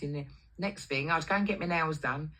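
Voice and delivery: ordinary talk, nothing marked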